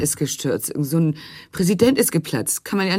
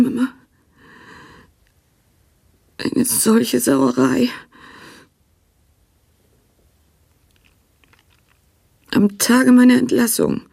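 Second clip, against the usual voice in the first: higher, louder, slow indignant outburst; bitter outrage; 8 semitones higher, 4 dB louder, slower than usual. Acted. Prisoner rails at her dead mother, outraged. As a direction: spit out the outrage, dragging it.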